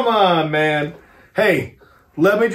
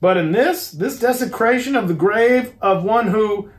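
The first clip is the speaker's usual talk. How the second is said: raised, rising outrage, words catching; choked indignation; 6 semitones higher, 3 dB louder than usual. A narrator's reading. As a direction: protest at the grave plan, barely holding back. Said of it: "he fairly choked with indignation".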